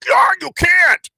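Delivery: loud and high, throwing hands up; exasperated shout. Retold or imitated. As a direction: shout it in disbelief. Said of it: "makes you go".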